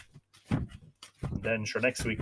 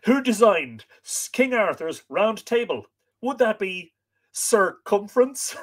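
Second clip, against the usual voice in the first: raised, loud quizmaster-like setup; higher and louder, landing the pun; joke delivery; 9 semitones higher, 6 dB louder, slower than usual. Real, not acted. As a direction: tell the joke with a big slow punchline.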